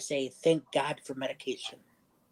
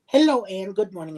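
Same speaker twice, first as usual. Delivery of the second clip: bright, louder hello, lifted up high; cheery greeting; 4 semitones higher, 7 dB louder than usual. Retold or imitated. Cheerfully greeting the receptionist Ann, a bit louder. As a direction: greet the receptionist with a sunny lift. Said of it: "I said".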